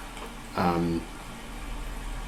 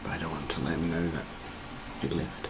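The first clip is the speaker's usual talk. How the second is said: quick, very soft mutter; secretive low murmur; hushed aside; 5 semitones lower, 16 dB quieter, faster than usual. Real, not acted. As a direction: say it under your breath, low and hurried. Player keeps plan from the orc.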